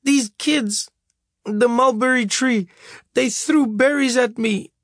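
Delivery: put-on little boy telling his mother, half-crying; sobbing child. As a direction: blurt it out between sobs. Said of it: "In between sobs".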